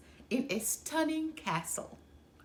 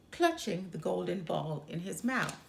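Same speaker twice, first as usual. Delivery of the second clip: ordinary delivery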